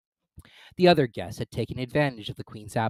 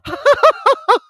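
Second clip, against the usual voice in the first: high cackle; 14 dB louder than usual. Acted. Duchess laughs at own joke.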